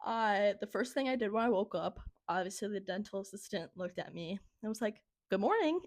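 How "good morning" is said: high sing-song good morning; pitched-up mock-sweet wake-up greeting; cheery greeting. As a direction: imitate an assistant greeting a waking patient. Dental assistant greeting her on waking.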